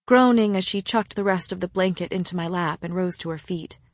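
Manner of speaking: plain reading voice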